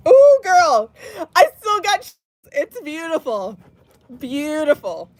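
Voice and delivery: high voice breaking into a cry; teary. Real, not acted. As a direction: overcome with tears. Reader still tearful after reading.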